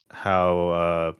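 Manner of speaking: usual speaking voice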